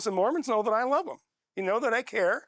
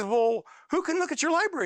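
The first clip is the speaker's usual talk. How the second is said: voicing the friend; 9 semitones higher than usual.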